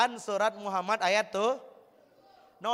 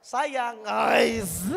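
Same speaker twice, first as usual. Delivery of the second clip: husband calling his wife with hammed-up love; proper loving call. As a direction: call "Sayang" out with full affection. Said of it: "yang betul, Mas".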